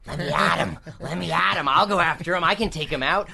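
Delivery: eager little imp, only lightly voiced; a hint of an impish stage whisper. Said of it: "is whispering".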